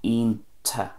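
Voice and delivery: "ionta" as EEN-ta; Ulster pronunciation. Shown as a demonstration. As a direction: say "ionta" with no ch at the end. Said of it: "lose the ch at the end".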